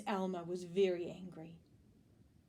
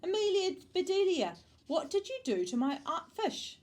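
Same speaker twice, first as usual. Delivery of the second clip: high, loud accusing question; angry shout; 11 semitones higher, 6 dB louder than usual. A narrator's reading. Mister Rogers confronts Amelia Bedelia over his fish, shouting. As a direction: demand it, loud and outraged. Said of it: "he shouted".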